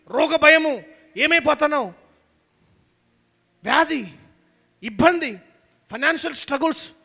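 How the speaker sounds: loud building list of fears; preaching at full force. Acted. Pastor listing fears to the church, loud preaching. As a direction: hammer each fear harder.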